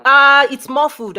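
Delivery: loud over a bad connection; to someone hard of hearing. Real, not acted. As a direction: project so the caller hears. Host struggling to hear caller.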